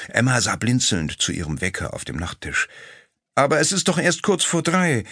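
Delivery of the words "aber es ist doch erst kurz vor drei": protesting. A narrator's reading. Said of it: "protestierte sie".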